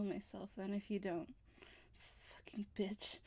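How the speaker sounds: slow, soft, low and spent; drained, low mutter. Acted. Exhausted captive mutters at captor, slow and soft. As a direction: let the voice sink, worn out.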